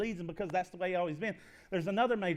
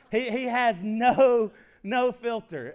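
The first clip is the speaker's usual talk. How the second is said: no drawn out slow and loud; emphatic; 3 semitones higher, 8 dB louder, much slower than usual. Real, not acted. Pastor telling the church his brother lacks a filter, emphatic. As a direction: lean hard on no, slowly.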